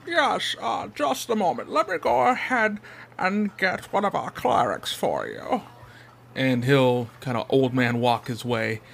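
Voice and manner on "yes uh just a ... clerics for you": put-on frail old man. GM voices the old Nurgling.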